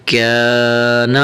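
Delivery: کان drawn out as "kaa-na" with a light k; thin, light kaf. Shown as a demonstration. Teacher showing the right reading, thin long "kaa" in کان. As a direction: read کان unrounded, vowel held long. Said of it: "تو پڑھنا ہے".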